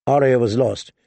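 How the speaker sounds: ordinary delivery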